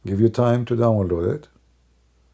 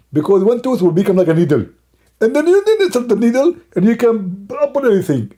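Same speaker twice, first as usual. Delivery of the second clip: much higher, louder put-on preacher voice; mock one-toothed preacher; 10 semitones higher, 6 dB louder than usual. Mocking a one-toothed preacher answering Brother Thitter, high and loud. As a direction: ham it up as a toothless sheikh lecturing his followers. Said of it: "I talk like this".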